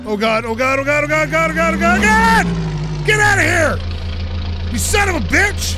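from loud worry up to a high shriek; terrified yelling turning into angry shouting; panicked screaming building. Real, not acted. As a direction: scream it as the crash comes, building to fury. Being rammed, screaming at driver.

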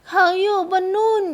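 put-on high little-girl voice, tearful ending; child's trembling voice. A narrator's reading. Fatherless girl pointing to heaven, trembling child voice. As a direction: let the child's last words waver. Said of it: "หางเสียงสั่นพร่า".

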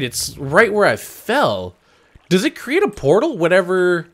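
ordinary talk

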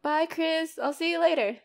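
loud farewell to someone across the room; calling out goodbye. Acted. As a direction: call it brightly across the bar. Calls goodbye to the bartender.

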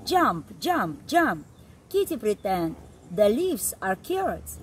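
ordinary delivery

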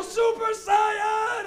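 high, loud, very drawn-out yell; kid's defiant shout. Retold or imitated. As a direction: imitate a kid proclaiming it at full volume. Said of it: "And I go".

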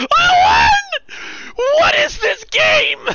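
high, loud, slow disbelieving cry; shouting in disbelief. Real, not acted. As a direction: yell in shock at the win. Disbelieving win.

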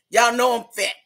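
ordinary delivery

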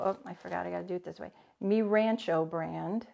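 ordinary delivery

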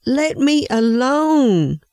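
high, slow drawn-out cry of protest; the clay crying out to its master to stop; yelled plea. A narrator's reading. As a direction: wail it out high and slow, begging to be left alone. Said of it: "and I yelled out".

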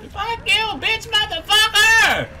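high, slow enraged yell; furious cursing. Real, not acted. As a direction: scream the insults. Cursing at the killer.

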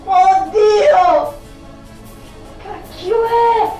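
high shrieking disgust; disgusted scream. Real, not acted. Touching cat food.

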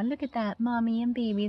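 ordinary delivery, nothing marked

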